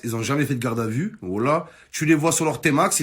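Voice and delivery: ordinary delivery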